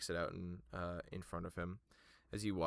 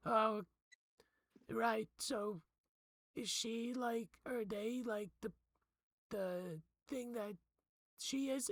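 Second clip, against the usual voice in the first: put-on much higher, curious young voice; Four's voice; 14 semitones higher than usual. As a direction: ask it up high and innocent. GM voices Four.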